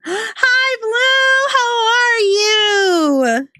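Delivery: very high, drawn-out hello; excited squealed greeting. Real, not acted. Streamer greeting an arriving viewer, excited squeal. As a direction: squeal it with delight, holding the vowels high.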